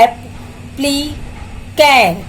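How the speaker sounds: Applicant slowly cut into three; very slow three-beat breakdown of applicant; syllable-by-syllable. Shown as a demonstration. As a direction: pause after each part of applicant. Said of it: "कितने sound है".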